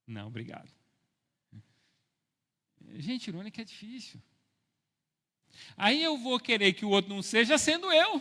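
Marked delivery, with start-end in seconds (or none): 5.8-8.2 loud, raised-pitch exclamation ending in a laugh; self-mocking outburst. Real, not acted. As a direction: exclaim it at your own expense. Self-mocking outburst about own irony.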